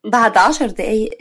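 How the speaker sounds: plain reading voice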